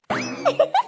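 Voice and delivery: a sudden very high squeal of laughter. Acted. Laughs at her own mix-up. (ಕಿರುಚಿದಂತೆ ನಗು)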